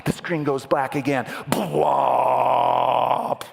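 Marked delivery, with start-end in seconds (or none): 1.5-3.4 loud, sustained cinematic boom; booming sound effect. Acted. Preacher sounding the movie trailer blackout, booming. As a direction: hold one long, loud bwomp.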